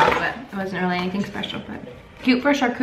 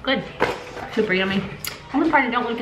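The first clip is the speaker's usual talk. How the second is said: speaking between bites; chewing while talking; faster than usual. Real, not acted. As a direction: mouth partly full of food. Seen 1: Speaker unclear; both women chew between words, cracker recently bitten.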